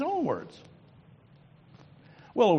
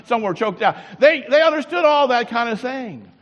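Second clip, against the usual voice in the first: loud, higher, fast driving preacher's cadence; preaching at full force; 7 semitones higher, 9 dB louder than usual. Acted. Preacher explaining the parable to his congregation, loud fervent preaching. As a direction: drive the parable home with fire.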